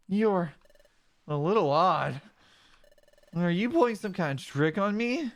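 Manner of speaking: gentle, lightly voiced young buddy; partner Mudkip voice. Mudkip partner telling about the Krabby bubbles, lightly voiced. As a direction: describe the sunset bubbles dreamily.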